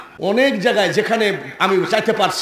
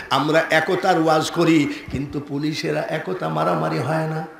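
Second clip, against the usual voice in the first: ordinary delivery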